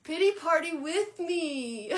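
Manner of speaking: loud, high, drawn-out whine; mock pleading wail. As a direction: beg playfully, stretching each word. Comic plea to Gaia.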